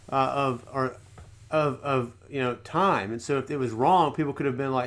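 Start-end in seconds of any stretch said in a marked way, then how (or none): none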